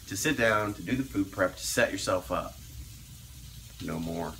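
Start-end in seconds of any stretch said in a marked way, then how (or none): 3.8-4.3 low, quiet, firm dad voice; daddy voice. Pretend. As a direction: lay down the law like a stern dad. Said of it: "Give you the daddy, give you the daddy voice".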